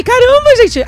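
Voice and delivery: surprised. Real, not acted. Shocked at the wig.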